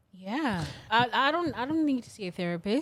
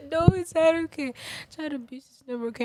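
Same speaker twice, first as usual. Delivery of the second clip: higher, laughing through the words; 7 semitones higher, 5 dB louder, faster than usual. Real, not acted. Co-host corrects the child abuse joke while laughing, amused protest. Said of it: "I'm sorry, I'm laughing because".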